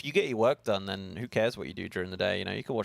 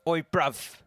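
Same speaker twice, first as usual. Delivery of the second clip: hammed-up Cockney-style British accent, pitched higher; mock British accent; 6 semitones higher, slower than usual. Australian streamer mocking a British accent for chat. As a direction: play a cheeky London bloke in a jokey British accent. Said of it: "That's how we talk".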